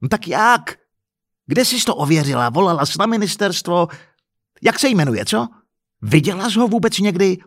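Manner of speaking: exasperated, scornful challenge; angry interrogation. Acted. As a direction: hammer her with demanding questions. Husband fires questions in quarrel.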